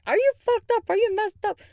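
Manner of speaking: high, loud mock outrage; squealing incredulous accusation; imitated freak-out. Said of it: "He is freaking out on me".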